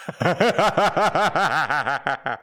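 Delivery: high unhinged laugh; mad chuckle. Acted. Narrator gloating over the old man, mad giggle. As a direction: laugh like a madman pleased with himself.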